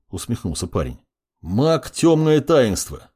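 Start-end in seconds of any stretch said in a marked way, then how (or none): 1.4-3.1 slow, high, ringing announcement; booming. Acted. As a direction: call his name out slowly and grandly. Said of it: "громогласно произнёс".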